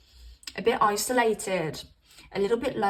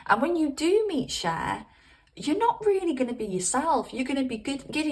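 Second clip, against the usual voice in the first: normal talking voice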